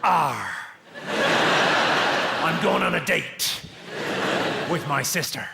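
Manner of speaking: slow, loud pirate growl of a West Country yokel. Retold or imitated. Comic mocking the West Country, hammed-up pirate voice. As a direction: ham up the pirate. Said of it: "Who can't do a fucking pirate voice?".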